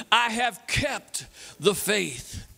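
very slow, spaced out for all to join; leading the crowd, very slowly together; slow call-and-response. Shown as a demonstration. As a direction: drag it out so the crowd follows. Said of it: "Say it with me".

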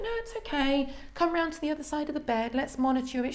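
high, sing-song reassurance; calm her down in a lilting voice; soothing midwife. Retold or imitated. Annie calms the mother.

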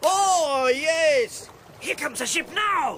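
high, loud sarcastic joy; wildly hammed-up delight; mock jubilation. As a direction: pretend to hail a rescue, jeering. Mocking hope of rescue.

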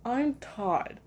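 stilted, hesitant self-introduction; mock-awkward vlog greeting; awkward intro. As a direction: imitate yourself being awkward on camera, with a pause after the greeting. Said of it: "I am such an awkward human being".

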